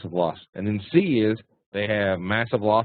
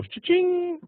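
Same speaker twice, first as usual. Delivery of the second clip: high-pitched imitation of a till ringing; cash-register cha-ching. Mimicking traders celebrating a small gain, high cha-ching. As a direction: sing out a cash register's ring. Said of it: "ring the register".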